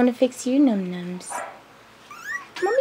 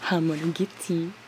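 hushed teasing; 5 semitones lower, 6 dB quieter than usual. Real, not acted. Playing with baby animal.

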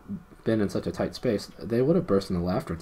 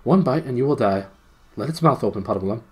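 loud, raised princely threat; commanding prince; 4 semitones higher, 7 dB louder than usual. A narrator's reading. Prince warns the biting gnome, commanding. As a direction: give a loud order with menace.

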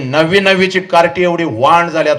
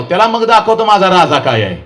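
ordinary talk, nothing marked

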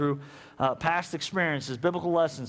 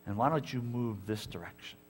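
lower, softer, slow gentle voice; softer lower gentle divine voice; voice of God; 6 semitones lower, 6 dB quieter than usual. Acted. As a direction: speak as a patient God. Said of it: "He's gonna say".

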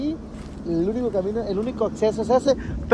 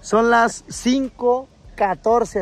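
slow stretched announcement; much slower than usual. Real, not acted. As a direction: drag out each word, slowly. (anuncia la hora muy despacio)

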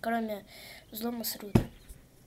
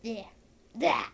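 ordinary talk

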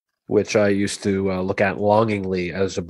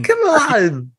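Walken impression; 4 dB louder than usual. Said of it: "Christopher Walken impression".

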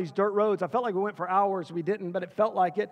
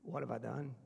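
low, very soft, broken-hearted plea to God; quiet despair, held back; retold tearful prayer; 8 semitones lower, 13 dB quieter than usual. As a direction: recall a crying prayer, hushed and low. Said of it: "I'm just crying".

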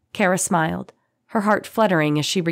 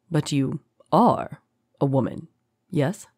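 smooth, faintly amused. A narrator's reading. Captor presses his point on his prisoner, smoothly amused. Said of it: "with a hint of amusement".

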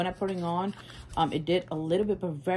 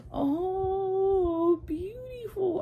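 sharp gasp, then a much higher "Oh, beautiful"; awed gasp; 13 semitones higher, much slower than usual. Real, not acted. Vlogger gasping at a palette shade, awestruck. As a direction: gasp as if the shade blinded you.

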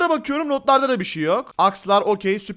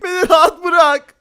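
loud, slow, whiny cry; hammed-up plea to be left alone; mock desperate shout; 3 semitones higher, 6 dB louder than usual. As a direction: drag it out as a protest. Shouts at kidnapper.

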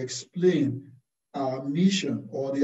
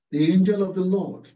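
angel given the weight, higher and louder; stress on angel; 3 semitones higher, 4 dB louder than usual. Shown as a demonstration. Preacher showing where the emphasis falls, stress on angel. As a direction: lean on the word angel to show the emphasis. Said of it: "The emphasis is on the angel".

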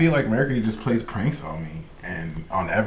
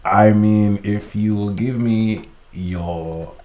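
put-on Nigerian prince accent. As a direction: play a Nigerian prince making a measured offer. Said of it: "can you do a Nigerian prince accent".

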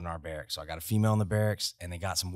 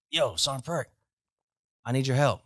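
calling out; 6 semitones higher, 5 dB louder than usual. Retold or imitated. Calling out to passing sergeant.

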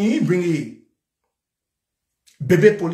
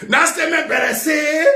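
angry shout; 6 semitones higher than usual. Real, not acted. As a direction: shout the demand. Shouted demand.

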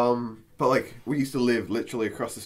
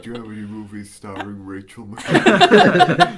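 low, loud put-on customer voice; hammed-up enquiry, pitched down; mock customer; 6 semitones lower, 8 dB louder than usual. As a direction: play the shop customer, half laughing. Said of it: "She walks up, she's like".

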